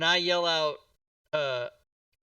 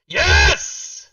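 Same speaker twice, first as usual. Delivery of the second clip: very loud, higher cheer; triumphant shout; 5 semitones higher, 16 dB louder than usual. Real, not acted. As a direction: shout it in victory. Celebrating a critical hit.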